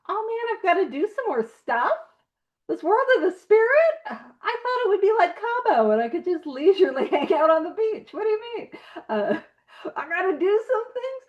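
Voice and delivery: mock whiny reluctance. Mocking a reluctant newcomer.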